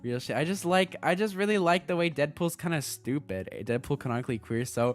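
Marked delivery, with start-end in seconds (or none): none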